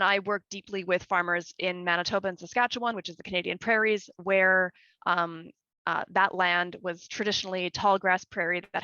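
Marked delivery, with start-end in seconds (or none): none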